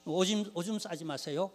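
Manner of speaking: ordinary talk, nothing marked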